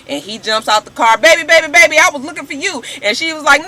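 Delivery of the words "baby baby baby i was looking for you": higher, louder wheedling; imitating Stevie J pleading with Mimi; mock pleading. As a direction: sweet-talk her, caught out.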